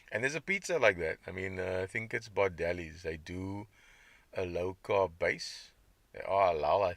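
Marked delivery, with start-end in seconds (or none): none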